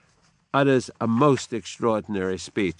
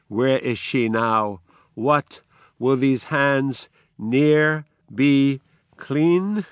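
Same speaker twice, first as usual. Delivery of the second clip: dramatic reading; 2 semitones higher, 3 dB louder than usual. Acted. Sleepwalking Lady Macbeth speaking to an absent husband, haunted reading. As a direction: let her madness creep in.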